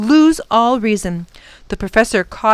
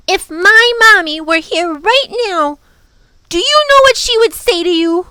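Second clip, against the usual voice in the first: high, loud outburst; indignant little girl; 10 semitones higher, 6 dB louder than usual. A narrator's reading. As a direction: tell them off, high and loud. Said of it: "had been pushed too far".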